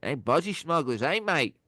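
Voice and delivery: put-on Aussie twang; rough Australian impersonation; Australian accent. Shown as a demonstration. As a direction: have another go at Australian. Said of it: "It's my Australian".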